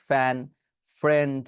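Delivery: ফ্যান, ফ্রেন্ড with aspirated p; two-lip pronunciation. Shown as a demonstration. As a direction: close both lips to start ফ্যান and ফ্রেন্ড. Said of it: "দেখবেন দুই ঠোঁট লাগিয়ে করি".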